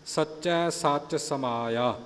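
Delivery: ਸਮਾਇਆ then a full pause, slow; slow with full stop. Shown as a demonstration. Teacher showing the pause to the sangat, slow. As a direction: land on ਸਮਾਇਆ and rest. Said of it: "ਇੱਥੇ ਵਿਸਰਾਮ ਆ ਗਿਆ ਨਾ".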